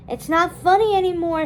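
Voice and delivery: loud, high call. A narrator's reading. Dawn calling to Felipa in the storm, loud. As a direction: call out, fed up and scared. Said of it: "she called to Felipa".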